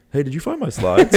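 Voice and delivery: imitating Eric. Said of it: "And then Eric was like".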